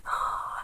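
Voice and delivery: soft, acted intake of breath; shocked gasp. Viewer seeing the murder on tape, acted gasp. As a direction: imitate gasping in shock.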